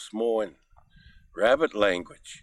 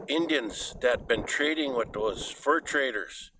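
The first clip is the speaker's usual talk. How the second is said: ordinary delivery, nothing marked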